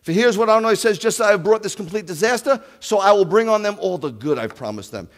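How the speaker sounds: preaching scripture. Acted. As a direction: declare God's word to the hall. Reads scripture with force.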